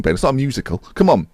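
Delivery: ordinary delivery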